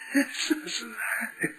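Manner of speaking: quiet, breath-led laugh; soft chuckle. Real, not acted. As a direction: chuckle gently to yourself. Laughs after describing the pleasure.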